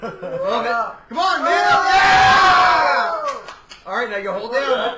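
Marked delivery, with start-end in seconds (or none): 1.1-3.1 high, loud whoop of encouragement; cheering shout. Real, not acted. Host urging the drinker to finish, cheering shout. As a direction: egg them on at full shout.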